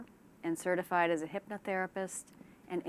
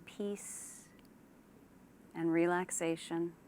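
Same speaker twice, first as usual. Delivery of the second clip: unhurried relaxation-exercise delivery; guided meditation; 4 dB quieter than usual. Shown as a demonstration. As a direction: ease them gently into calm, leaving space after each phrase. Leads a guided imagery relaxation.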